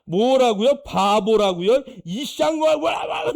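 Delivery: loud, high outraged yell; furious wife. Retold or imitated. Pastor's wife yelling at the men who insulted him, furious shout. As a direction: scare the men off with a furious outburst. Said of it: "우리 사모가 열받아".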